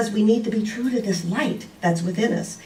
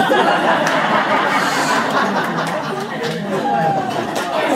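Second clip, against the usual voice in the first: big breathy laugh after the punchline; hearty laugh; 9 semitones higher, 5 dB louder than usual. Real, not acted. Laughing at her own story before the congregation. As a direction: let the laugh burst out.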